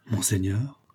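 usual speaking voice

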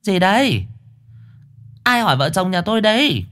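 high-voiced nosy wife; bà Ái lến thoáng. A narrator's reading. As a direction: pipe up high as the busybody wife. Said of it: "Bà lến thoáng". (giọng bà vợ hóng hớt, cao vút)

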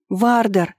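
contained cry to someone distant; calling out. A narrator's reading. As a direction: raise the pitch and call the name. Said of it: "позвала вновь".

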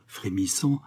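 ordinary delivery

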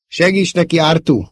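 usual speaking voice

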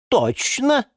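high-pitched eager agreement; agreeing gossip. A narrator's reading. Розалия Львовна backs up the geranium, agreeing eagerly. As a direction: chime in high, backing her up. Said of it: "поддакнула Розалия Львовна".

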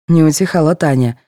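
ordinary delivery, nothing marked

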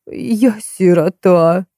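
slow, snivelling crone; whimpering old woman. A narrator's reading. Faina whimpering to Marta for pity, slow and snivelling. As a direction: whine it out like a pitiful orphan. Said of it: "захныкала Фаина".

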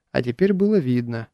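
usual speaking voice

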